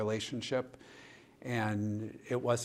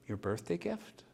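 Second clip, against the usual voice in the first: higher, surprised rising question; puzzled question; 8 semitones higher, 3 dB quieter than usual. Retold or imitated. Puzzled by the patient's gift name, higher surprised question. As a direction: ask it in disbelief.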